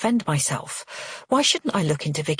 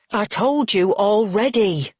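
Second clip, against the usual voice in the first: louder, higher irritated snap; snapping; 4 semitones higher, 5 dB louder than usual. A narrator's reading. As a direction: snap back with raised voice. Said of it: "Frank snaps".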